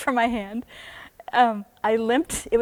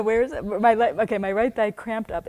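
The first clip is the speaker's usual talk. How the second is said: ordinary talk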